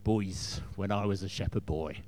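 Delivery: put-on low, gruff, monotone old king; lightly voiced gruff warrior king. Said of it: "with a sort of monotone, gruff voice".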